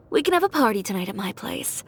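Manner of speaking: usual speaking voice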